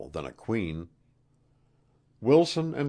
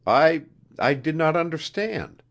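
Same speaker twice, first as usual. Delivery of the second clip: higher, stammered apology; begging pardon; 10 semitones higher, 6 dB louder than usual. A narrator's reading. Wilson confused by the queen's answer, begging pardon. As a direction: stumble into an apology, voice raised. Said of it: "begged Wilson".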